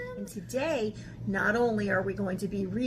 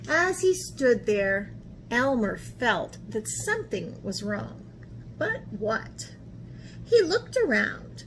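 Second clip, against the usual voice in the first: ordinary delivery, nothing marked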